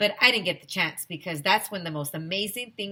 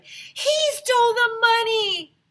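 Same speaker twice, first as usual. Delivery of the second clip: much higher, slow accusing shout; alarmed shout; 7 dB louder, slower than usual. A narrator's reading. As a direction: point him out to everyone. Kid alerting whole crowd.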